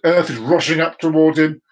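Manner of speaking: normal talking voice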